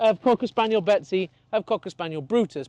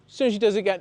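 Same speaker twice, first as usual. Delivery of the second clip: ordinary talk, nothing marked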